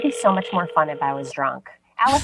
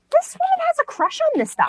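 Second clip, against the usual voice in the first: much higher, mock-scandalized inner voice; inner thought. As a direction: squeal it like a juicy realization. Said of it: "I thought to myself".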